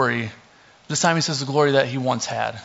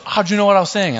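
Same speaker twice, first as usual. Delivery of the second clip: higher, louder retold question; imitating amazed wife; 6 semitones higher, 6 dB louder, faster than usual. Wife asks how husband knew her words, amazed. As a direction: voice her mild astonishment, pitched up and louder.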